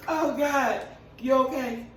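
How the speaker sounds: ordinary delivery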